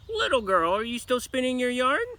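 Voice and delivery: witch calling. Acted. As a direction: call out like Baba Yaga. Witch calls again.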